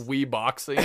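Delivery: normal talking voice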